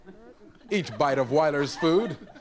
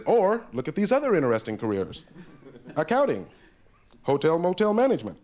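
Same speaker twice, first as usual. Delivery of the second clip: mock ad read; 2 semitones higher than usual. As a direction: announce careers like a cheesy ad. Matchbook ad list.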